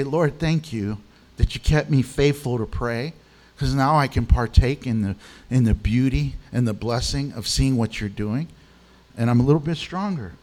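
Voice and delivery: soft, low thanks to the Lord; hushed thankful prayer; quiet grateful prayer. Retold or imitated. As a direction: pray it gently, humbly.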